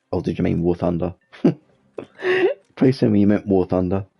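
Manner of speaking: ordinary talk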